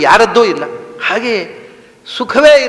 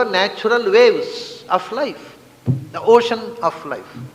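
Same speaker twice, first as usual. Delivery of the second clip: slow emphasis; 5 dB quieter, much slower than usual. Real, not acted. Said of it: "slow emphatic English phrase".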